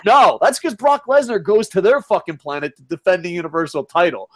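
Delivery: ordinary talk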